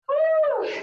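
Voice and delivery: high, loud whooping cheer; victory cheer. Real, not acted. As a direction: shout it out, workout done. Celebrating end of workout.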